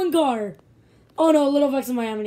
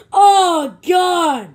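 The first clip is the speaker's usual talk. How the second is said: loud startled shout; alarm shout; 3 semitones higher, 8 dB louder than usual. Real, not acted. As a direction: yell it as the car crashes. Car crash in race.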